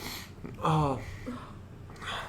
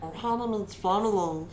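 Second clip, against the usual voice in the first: Stitch voice; 5 semitones higher, 4 dB quieter than usual. Pretend. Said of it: "I can do Stitch".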